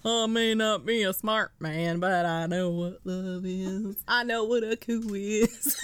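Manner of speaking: slow, drawn-out movie-character impression; Forrest Gump. Movie fan parodies a famous line about love, slow impression. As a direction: take it slowly, word by word, earnest and simple. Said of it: "I wanna watch Forrest Gump".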